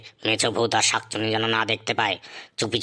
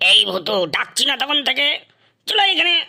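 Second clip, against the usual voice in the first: loud, rising call across a distance, higher and higher; 12 semitones higher, 5 dB louder than usual. Acted. Guru yelling for Bhutu to come back, loud rising call. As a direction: shout for the little ghost to come at once.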